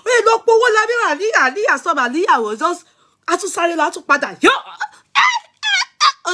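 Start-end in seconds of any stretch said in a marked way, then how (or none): 0.1-2.0 scolding the dog. Retold or imitated. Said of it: "Mọ́mì mi tún ní".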